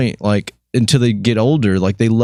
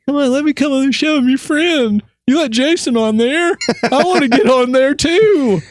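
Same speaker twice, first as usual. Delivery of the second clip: mock whiny plea, pitched way up in a put-on accent; whining and begging. Host teases his guest about begging onto the show, whining. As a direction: beg like a pestering friend, very high. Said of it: "You kept whining and begging me".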